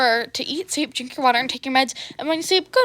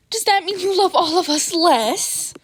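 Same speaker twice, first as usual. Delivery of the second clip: mock-wounded kid, higher and louder, slow; theatrical gasp; 7 semitones higher, 5 dB louder than usual. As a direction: ham up the hurt feelings. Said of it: "Izuku gasped theatrically".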